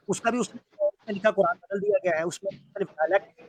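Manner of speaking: breaking up over a bad connection; choppy, cutting-out call audio; buffering stutter. Real, not acted. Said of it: "आपकी आवाज़ थोड़ी cut रही है". (कटती हुई आवाज़)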